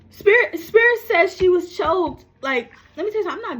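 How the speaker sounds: high, loud, indignant insistence; getting angry. Real, not acted. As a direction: raise your voice in angry protest. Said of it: "I'm getting a little angry right now".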